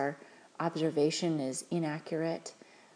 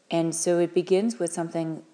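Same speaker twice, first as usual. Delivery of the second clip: ordinary delivery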